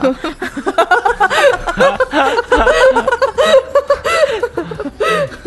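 high, long helpless laughter; long laughing fit. Real, not acted. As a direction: crack up for several seconds. Long laugh after joke.

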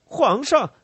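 loud, high outburst; frantic high-pitched yell; furious shout. A narrator's reading. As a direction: cry out to the emperor in a rage. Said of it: "他狂怒的大声说".

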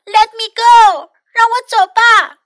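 loud, high-pitched indignant protest; big yelling voice. A narrator's reading. Trapped mouse refusing to be a pet, yelling. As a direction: yell it big, far up in pitch. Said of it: "yelled in a big, big voice".

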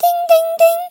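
very high, ringing "ding, ding, ding"; three loud, high bell dings; imitated bell. A narrator's reading. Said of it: "the babies heard something".

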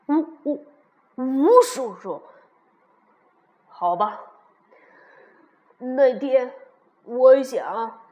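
loud, big T-rex voice; big Tyrannosaurus. A narrator's reading. T-rex asking the little one why he came, loud and big. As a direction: play the big dinosaur, booming.

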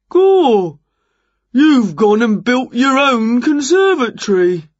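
loud, high, slow astonishment; amazed builder. A narrator's reading. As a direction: marvel at it as a workman would. Builder amazed by the new structure.

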